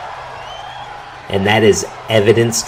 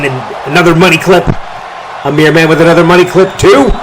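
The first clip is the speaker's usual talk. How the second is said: excited call; 6 semitones higher than usual. Real, not acted. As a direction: yell out the big move. Calling a repeated finisher.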